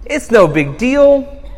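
usual speaking voice

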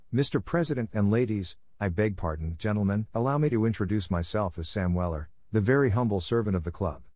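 ordinary delivery, nothing marked

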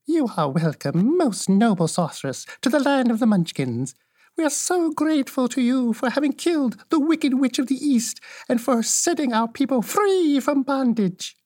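put-on high, sweet little old woman's voice; sweet old witch. Little old woman welcomes Dorothy to the land, sweetly high. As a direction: greet the girl gently as a kindly old witch. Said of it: "said in a sweet voice".

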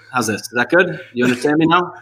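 put-on American accent. Guest asks the host if he understands him in an American accent. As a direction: drop the German and check in with a light American accent. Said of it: "I can try, like, an American accent".

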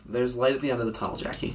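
ordinary talk

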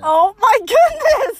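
slow, high, loud laughing exclamation. Real, not acted. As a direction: crack up mid-phrase. Laughing at his tantrum.

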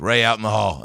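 loudly imitated teacher's order. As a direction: send a kid out, mock-strict. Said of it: "She goes".

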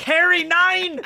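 high, loud mock-German shout; angry German. Imagining Harry Potter read in German, angry German shout. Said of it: "like German or something".

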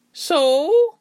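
put-on high-pitched old landlady, drawn out; little old innkeeper. A narrator's reading. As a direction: open nosily as a little old lady. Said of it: "A frail little lady".

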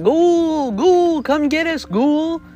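shouting a challenge from the barn roof; hollering. Retold or imitated. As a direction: holler up at the ghoul, taunting. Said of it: "you're still hollering".

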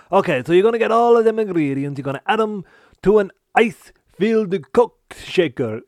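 put-on a loud, quick Italian accent; a hammed-up Italian chef accent; mock Italian accent. Finger Chef, explaining the cocktail, in a comic Italian accent. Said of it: "you now sound Italian".

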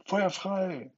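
high, loud order; fire command. Acted. A boy ordering the attack on Wendy, shouting.

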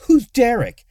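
loud, high irritated question; spat out. A narrator's reading. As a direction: spit the question out sharply. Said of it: "Luna spat".

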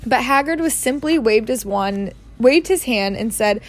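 plain reading voice

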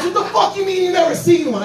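high, fast incredulous retort; mock outrage. Angry guy challenges the drunk's excuse, mock outrage. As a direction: imitate an angry guy's rapid comeback.

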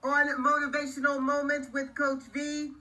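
slow, clear outro announcement; announcer read. Acted. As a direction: read it like a podcast sign-off.

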